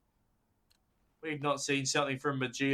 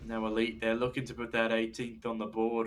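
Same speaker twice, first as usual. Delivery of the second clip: ordinary talk, nothing marked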